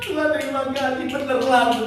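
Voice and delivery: seruan haru bernada sangat tinggi; tangis syukur yang ditiru. Retold or imitated. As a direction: tirukan tangis berterima kasih kepada Tuhan. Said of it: "Saya nangis". (pura-pura menangis haru, nada tinggi)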